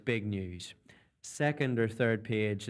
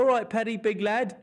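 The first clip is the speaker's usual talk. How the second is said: higher, English try at big lad; London camera crew; 9 semitones higher, 4 dB louder than usual. Retold or imitated. London camera crew greeting Paddy in an English accent. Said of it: "it doesn't sound the same when you've got the Northern Ireland accent going".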